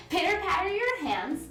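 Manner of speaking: ordinary talk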